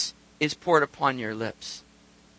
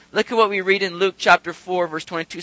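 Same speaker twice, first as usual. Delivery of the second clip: ordinary talk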